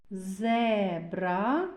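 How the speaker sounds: зебра with the e held long; stretched vowel e. Shown as a demonstration. Teacher picking out a vowel for children, long e in зебра. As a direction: draw out the e in зебра slowly. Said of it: "Е голосний".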